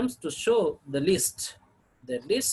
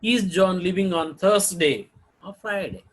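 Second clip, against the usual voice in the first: "Thursday" rising, "Friday" falling; choice question; 5 dB louder than usual. Shown as a demonstration. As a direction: offer two days, lifting "Thursday" and dropping "Friday". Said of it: "first tone group may have the rising tone".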